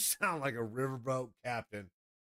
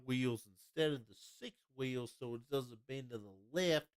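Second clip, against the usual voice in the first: Southern accent; 2 semitones higher than usual. Shown as a demonstration. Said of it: "you can go down southern".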